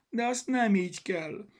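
high, loud wail of complaint; tearful voice. A narrator's reading. Bogi objects to Zorka's way of studying, tearfully. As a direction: protest as if close to tears, pitched high. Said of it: "szólalt meg sírós hangon Bogi".

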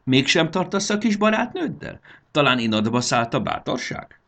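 high, loud mocking questions; teasing taunt. A narrator's reading. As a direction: goad him about his courage. (gúnyosan cukkoló hang)

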